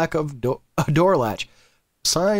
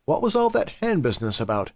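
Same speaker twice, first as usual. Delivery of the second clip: ordinary talk, nothing marked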